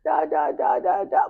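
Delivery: high, whining, tearful protest; whiny tearful. Retold or imitated. Answering mom about Cambridge, high whiny tearful protest. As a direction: whine like a sulking teen. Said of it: "I was like".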